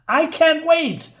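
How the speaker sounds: slow, high mock eagerness; sarcastic. Real, not acted. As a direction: drip sarcasm, drawn out. Sarcasm about the plot.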